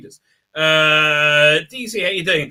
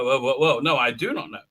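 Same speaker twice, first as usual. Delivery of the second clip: normal talking voice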